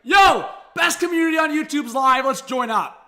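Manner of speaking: mock hype shout to chat; imitating a fan calling out from their phone; hyped viewer. Streamer voicing a fan joining the stream, mock hyped. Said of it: "be like".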